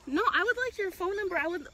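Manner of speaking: high-pitched, upset insistence; real agitated demand; insistent demand. Real, not acted. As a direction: firmly demand details from the dog's owner. Demanding owners' number after bite.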